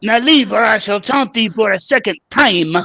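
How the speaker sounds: hammed-up French taunt, loud and high; mock French taunt. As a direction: taunt them like a silly French knight. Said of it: "How is your French accent".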